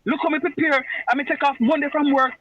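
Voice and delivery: high, mocking student complaint; whiny complaining student. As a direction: mimic a student whining in patois. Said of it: "hear nobody say nothing to me about".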